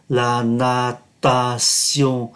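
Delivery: "La natation" very slow, TION as "sion"; drawn-out model reading of "La natation"; slow French demo. Shown as a demonstration. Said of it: "T I O N thì phát âm là sion". (đọc mẫu thật chậm "La natation", TION đọc là "sion")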